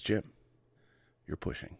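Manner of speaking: lightly voiced McCoy, hushed and measured, half-whispered on the pushing line; quiet, measured doctor warning his captain, lightly imitated; DeForest Kelley. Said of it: "when DeForest Kelley says".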